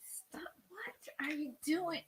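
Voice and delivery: hushed whisper. Real, not acted. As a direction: breathe the words quietly. Whispering while tickling.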